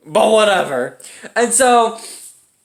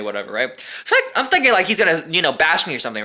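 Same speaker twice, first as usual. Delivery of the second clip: ordinary talk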